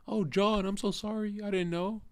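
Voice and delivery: high, whiny mocking apology. Streamer mocking chat viewers, in a high whiny mock apology. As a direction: pitch it up and simper like a sorry fool.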